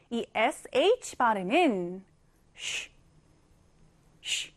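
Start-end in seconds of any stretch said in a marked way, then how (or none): 2.5-2.9 쉬 as a quiet, voiceless English sh; soft SH sound. Shown as a demonstration. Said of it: "이렇게 발음됩니다". 4.2-4.5 쉬 as an isolated English sh; SH sound demo. Shown as a demonstration. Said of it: "이렇게 발음됩니다".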